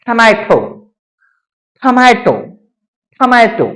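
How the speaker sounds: टमाटो starting with a breathy ठ; टमाटो said as "thamaeto", slowly repeated; aspirated T. Shown as a demonstration. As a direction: puff air on the first T of टमाटो. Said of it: "को ठा का sound निकालेंगे".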